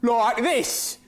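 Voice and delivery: teasing the Australian newcomer with a mock accent. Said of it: "are you always going to sound like that?".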